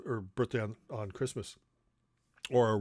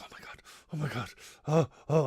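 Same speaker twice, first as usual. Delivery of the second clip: put-on a child about to hold magic; excited kid; 2 semitones higher, faster than usual. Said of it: "I'd be so excited".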